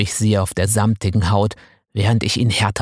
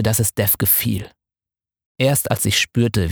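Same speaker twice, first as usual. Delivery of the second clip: ordinary delivery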